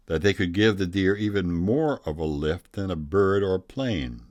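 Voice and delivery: ordinary delivery, nothing marked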